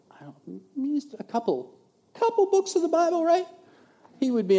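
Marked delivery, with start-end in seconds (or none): 0.7-3.5 high-pitched, offhand mock uncertainty; joking understatement. Real, not acted. Preacher jokes to the church about Paul's writings, wry understatement.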